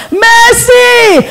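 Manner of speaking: high desperate scream of the damned; anguished cry for mercy. Retold or imitated. Preacher voicing the damned in hell, screaming for mercy. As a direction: wail it like someone burning. Damned souls crying out in fire.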